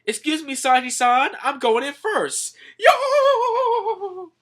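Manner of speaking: high voice rising into a loud, long whoop; gleeful announcement. Retold or imitated. Skeleton excuses himself to go in first, gleeful. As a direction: announce it, then let out a whoop.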